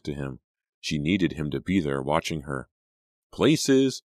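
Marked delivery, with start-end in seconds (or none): 3.3-4.0 higher, louder call to places; shouting. A narrator's reading. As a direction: shout it across the stage. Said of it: "Mister Riordan's shout".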